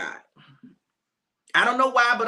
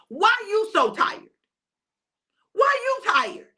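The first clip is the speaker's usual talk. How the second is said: Jesus fed up with his sleepy disciples; exasperated question; 8 semitones higher than usual. Acted. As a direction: demand to know why they're tired. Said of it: "Jesus was frustrated with them".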